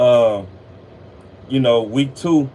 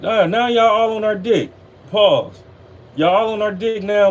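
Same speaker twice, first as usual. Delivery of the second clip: mocking scorn; 8 semitones higher than usual. Real, not acted. Mocking the fans who jumped on.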